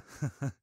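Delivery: quick high laugh at himself; high sheepish laugh. Acted. Vampire caught out about looking dead, quick high laugh.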